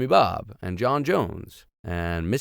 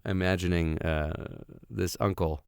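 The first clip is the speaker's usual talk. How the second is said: plain reading voice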